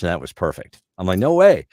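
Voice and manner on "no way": high, loud mock disbelief; astonished disbelief. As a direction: re-enact amazement with pitch shooting up. Retold astonishment at the news.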